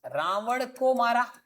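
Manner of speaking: very slow, each word spaced; slow example. Shown as a demonstration. Teacher to class, slow dictating voice. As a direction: lay out the sentence slowly for the board. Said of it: "ठीक है, देखो".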